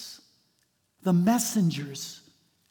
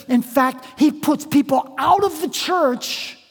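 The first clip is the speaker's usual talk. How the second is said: raised, emphatic pulpit delivery; preaching; 5 semitones higher, 7 dB louder than usual. Real, not acted. Pastor telling the church about Diotrephes, emphatic preaching. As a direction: drive the story home to the congregation.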